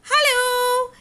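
high little-fish call; Sterling calling to a friend. Acted. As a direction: call ahead to the shimmer. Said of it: "he called".

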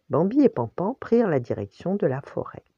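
plain reading voice

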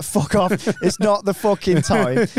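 retold drunken protest, dragging the words; annoyed outburst. Drunk mate kicking off at police, mock exasperated.

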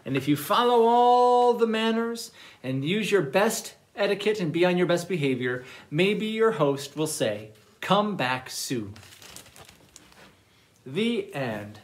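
animated storytime narration with big swings in pitch; reading to children. Acted. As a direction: read a playful picture book to little listeners. Reads manners story to children.

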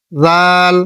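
জাল very slow and drawn out; letter demo. Shown as a demonstration. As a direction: stretch জাল to show the sound. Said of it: "আলাদা".

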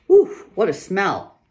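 loud, high, slow groan of disgust; disgusted exclaim. Acted. Pa noticing the skunk smell, loud slow disgust. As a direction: recoil from the stink.

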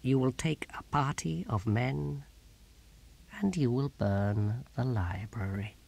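slow, hushed, deadly order; near-whispered, measured threat; quiet menace. Acted. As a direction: give the order softly and deliberately. Tyrant gives menacing quiet order.

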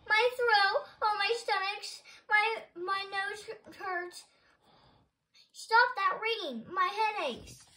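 high, loud, drawn-out wails; hammed-up pained yelling; clamouring complaints. A narrator's reading. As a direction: wail each ailment like an upset crowd. Upset patients clamour at once.

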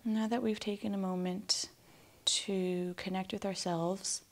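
calm meditation voice with long, restful pauses; guided meditation. Acted. Guide asking listeners to relax and breathe, calm meditation voice. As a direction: ease them gently toward stillness, leaving space to breathe.

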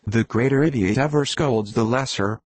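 plain reading voice

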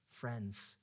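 normal talking voice

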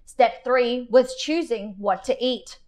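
plain reading voice

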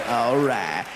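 very low drawled impression of a movie star; Matthew McConaughey. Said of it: "he looks at me and he says".

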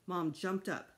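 plain reading voice

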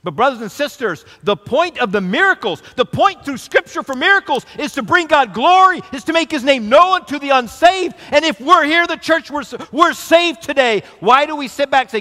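fervent sermon call; preaching. Acted. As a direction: drive the point home to the church. Preaching climax to congregation.

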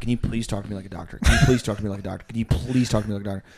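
rapid repeated plea; pleading in my head. Retold or imitated. Patient silently begging the doctor, fast and pleading. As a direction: rattle off the plea fast, over and over. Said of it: "in my brain, I was like".

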